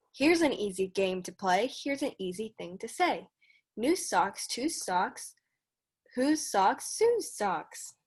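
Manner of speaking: measured storybook read-aloud; reading to children. Acted. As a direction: read the rhyme steadily for the kids. Teacher reads Dr. Seuss aloud.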